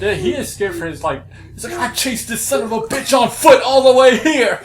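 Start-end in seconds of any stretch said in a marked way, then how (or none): 1.8-4.6 high, loud, outraged boast; imitated angry screaming. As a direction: imitate a furious pursuer yelling. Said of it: "fucking screaming at the top of his voice".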